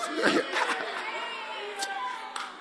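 high hearty burst of laughter; big laugh. Real, not acted. Preacher laughing with congregation, hearty laugh.

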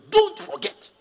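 high, loud cry of don't forget; loud warning. Acted. Preacher warning the flock not to forget, loud high cry. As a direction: shout "don't forget" up high then land it hard.

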